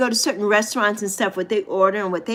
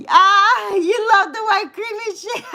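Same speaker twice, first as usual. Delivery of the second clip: laughing; 10 semitones higher, 4 dB louder than usual. Real, not acted. Laughing teasing reaction.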